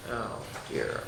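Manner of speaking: breathy whisper of pity; whispered oh dear; whispered aside. Real, not acted. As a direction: drop to a soft whisper. Aside while showing the picture.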